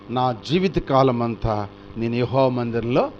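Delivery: slow, low verse intoned; solemn recital. Acted. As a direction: read the vow like holy scripture. Reciting David's vow.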